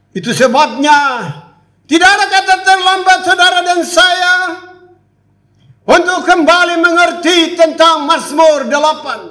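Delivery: high, loud preaching cry; fervent preaching. Acted. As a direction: preach it out to the congregation. Preacher urges, full force. (khotbah berapi-api dengan suara tinggi dan keras)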